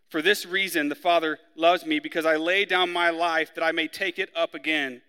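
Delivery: measured, solemn Bible reading to the congregation; scripture reading. Acted. Preacher reading scripture to the church, solemn. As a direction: read the verses out with weight. Pastor reads John aloud.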